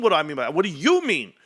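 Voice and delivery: slow, stretched What do you mean with weight on "you"; mocking slow repeat; slow drawn-out echo. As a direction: drag it out, leaning on "you". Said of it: "I said".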